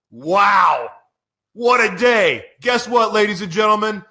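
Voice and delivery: high, loud joyful shout; overjoyed host shouting to the listeners; excited. Real, not acted. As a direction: burst out with pure delight.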